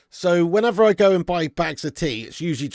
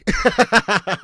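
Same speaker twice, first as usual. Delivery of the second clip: laugh; 6 semitones higher, 6 dB louder than usual. Real, not acted. Laughs at own joke about Evans.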